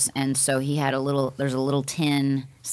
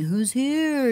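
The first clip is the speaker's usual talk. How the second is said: higher, hammed-up call to the spirits; mock spooky call; 10 semitones higher than usual. Kids goofing around calling to a ghost, mock spooky higher call. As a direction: tease the ghost like an immature kid. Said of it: "like, asking".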